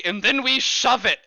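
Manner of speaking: mimicked call to action, high and loud; imitated battle cry. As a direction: echo a teammate's shout. GM echoes Yannick's shouted order.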